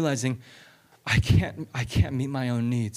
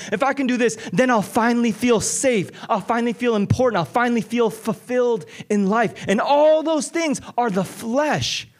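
high, driving sermon voice; pleading with the congregation, urgent preaching; impassioned preaching; 8 semitones higher than usual. Acted. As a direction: preach it with rising fire. Preaching builds to full force.

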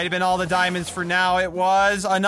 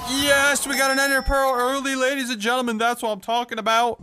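triumphant cheer; 5 semitones higher than usual. Real, not acted. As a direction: celebrate the win. Got the ender pearl.

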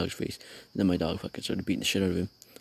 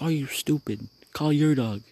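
higher, indignant retold outburst; retold angry scolding; 5 semitones higher, 3 dB louder than usual. Telling off the other owner, retold indignation. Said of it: "I was like, I was like".

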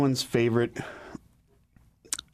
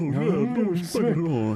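Rodney Dangerfield impression; 5 semitones higher than usual. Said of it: "Rodney Dangerfield".

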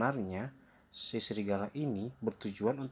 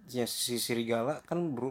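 usual speaking voice

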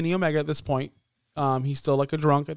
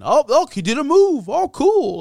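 mock commentary; 10 semitones higher, 5 dB louder than usual. Mocking Punk's bored commentary.

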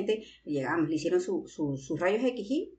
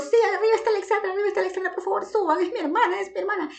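high, loud, fast urgent pleading; urgent calling; 12 semitones higher, 4 dB louder than usual. Retold or imitated. Sister retelling how she urged the paramedics upstairs, high and urgent.

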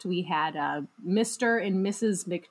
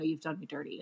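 ordinary talk

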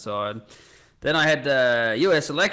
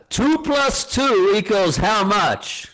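high, slow, drawn-out quiz question; American boss announcing the big question; quizmaster's question; 8 semitones higher, 3 dB louder, slower than usual. Acted. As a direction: pose it grandly, stretching each word. Boss poses the question again.

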